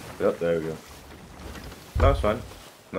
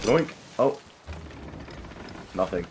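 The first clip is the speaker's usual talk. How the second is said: ordinary delivery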